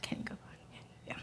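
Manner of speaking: whispered request; whispering. Real, not acted. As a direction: whisper it aside. Asks operator to go back.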